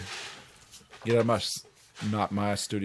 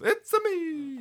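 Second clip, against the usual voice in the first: very high cartoon Italian squeal; high hammed-up mock Italian; Mario; slower than usual. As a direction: sing out the game plumber's catchphrase. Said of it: "read it in Mario terms".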